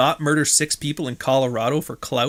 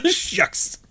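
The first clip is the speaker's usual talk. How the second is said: squeaky laughing shucks. Pretend. Joke punchline after Shuckespeare.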